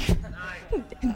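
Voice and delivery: soft unvoiced chuckle; breathy laugh. Real, not acted. Laughing at own story.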